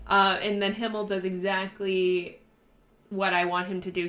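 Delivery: usual speaking voice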